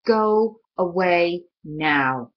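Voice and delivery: slow, pressing go away; will the nightmare away, slowly; slow firm plea. Acted. Connor begging the nightmare to leave, slow and firm.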